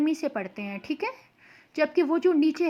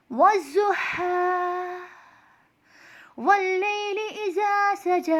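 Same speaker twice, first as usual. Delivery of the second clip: slow, sustained chanting with long-held vowels; Quran recitation; 5 semitones higher, much slower than usual. Shown as a demonstration. Teacher opens a Quran lesson by reciting the verses, slow melodic recitation. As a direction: recite slowly, pitched a little higher, drawing out each long vowel.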